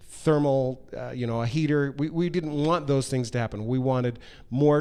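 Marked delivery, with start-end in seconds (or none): none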